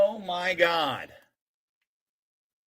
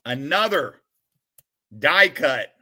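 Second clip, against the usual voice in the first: ordinary talk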